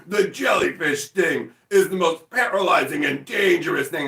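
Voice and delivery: loud, high, hyped-up danger narration; overblown TV announcer. Retold or imitated. Announcer hyping a jellyfish sting, melodramatically intense. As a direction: hammer it like a deadly threat. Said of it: "announcer who has to make everything seem super intense".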